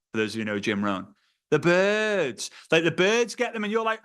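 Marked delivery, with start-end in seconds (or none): none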